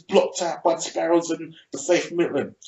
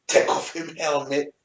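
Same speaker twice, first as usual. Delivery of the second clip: ordinary delivery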